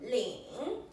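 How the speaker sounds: "Ling" dipping down, then rising; third tone on Ling. Shown as a demonstration. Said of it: "That's the third tone".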